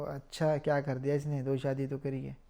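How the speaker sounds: low, soft behind-the-back remark; imitated backbiting. Acts people talking behind his back. (पीठ पीछे धीमी बुराई की नकल)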